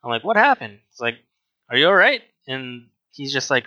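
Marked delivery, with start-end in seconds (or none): 0.2-0.8 high-pitched startled question; retold startled, worried question; alarmed concern. Coworker to a tearful customer, alarmed concern. Reacts to man in tears. 1.7-2.2 high, louder worried check; retold urgent, worried question; alarmed concern. Checking on a tearful old customer, alarmed concern.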